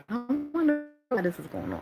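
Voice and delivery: high, musing voice for a thought; mock wondering. Wondering to oneself about what is happening, high-pitched mock musing. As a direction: muse to yourself, pitched well up. Acting out her own wondering.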